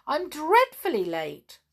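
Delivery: put-on high, loud, emphatic boyish exclamation; surprised. A narrator's reading. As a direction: insist on it with astonished emphasis. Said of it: "said Sandy in surprise".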